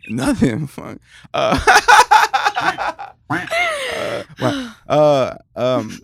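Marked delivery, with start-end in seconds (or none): 1.6-3.3 high laugh. Real, not acted. Host cracks up.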